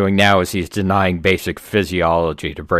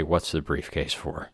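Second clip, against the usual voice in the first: softer, slow and weary; barely muster the words; softer, slow exhausted question; strained effort; 2 semitones lower, 9 dB quieter, slower than usual. Acted. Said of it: "musters all of his effort to say".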